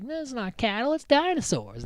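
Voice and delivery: high-pitched retort; mock protest. Acts out kids arguing back.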